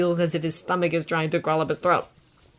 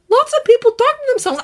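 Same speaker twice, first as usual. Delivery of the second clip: nervous; 9 dB louder than usual. A narrator's reading. Izuku deflecting, nervous.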